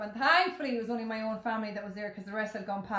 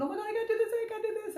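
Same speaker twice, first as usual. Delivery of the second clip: high panicked wail, mock terror; imitating a screaming scared kid; 12 semitones higher, much faster than usual. Son frozen at the slide top, high mock panic. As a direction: squeal it like a frightened boy. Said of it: "he's, like, screaming".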